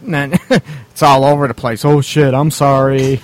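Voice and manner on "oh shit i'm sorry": slow, louder, drawn-out mock apology; hammed-up "oops" apology, drawn out; sheepish mock apology. Host imitating an embarrassed guy after finishing early, sheepish mock apology.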